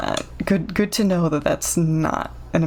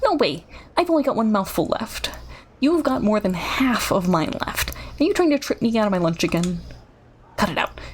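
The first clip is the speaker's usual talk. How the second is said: rushed character voice; fast talking; faster than usual. Acted. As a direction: rattle it off quickly. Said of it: "I'm trying to speak so fast".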